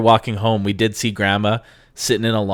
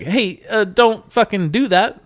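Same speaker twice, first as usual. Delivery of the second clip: high, slow warning to a stranger; a dad snapping to back off; mock angry parent; 11 semitones higher, slower than usual. Parent warning a stranger lifting his child, mock angry.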